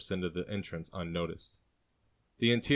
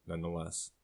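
ordinary delivery, nothing marked

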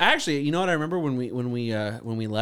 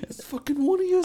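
much higher, harsh Irish scold; Irish mom impression. Host repeats the Irish mom's line, harsh Irish accent. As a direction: bark it like a furious Irish mum. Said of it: "the video where the mom busts in".

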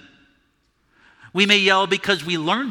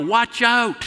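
high, urgent call of warning; yelled warning; 8 semitones higher than usual. Shown as a demonstration. Preacher demonstrating a warning shout at a work site. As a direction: shout it up to workers below a falling load. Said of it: "it's appropriate to yell".

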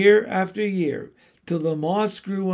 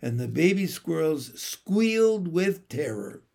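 usual speaking voice

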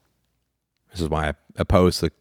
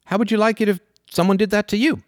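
high, lilting scolding parent; 12 semitones higher than usual. Retold or imitated. Parent to child who grabbed a toy, mock scolding. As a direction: chide the child like a patient parent. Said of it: "and the parent says".

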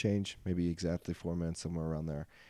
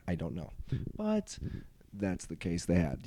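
normal talking voice

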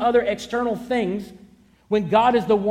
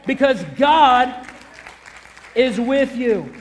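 louder, rising preacher's climax; preaching build; 3 semitones higher, 3 dB louder than usual. Acted. Preacher rallies the congregation to rise up, building. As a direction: lift them up to the final line.

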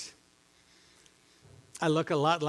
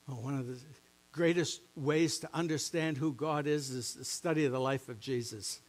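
normal talking voice

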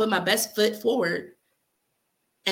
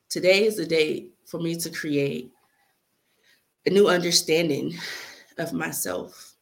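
spoken word poem; 2 semitones lower than usual. Acted. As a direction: recite the letter like a heartfelt poem. Recites her letter to yesterday.

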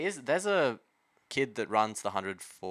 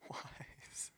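whispered; 18 dB quieter than usual. Real, not acted. Whispered repeat of wise.

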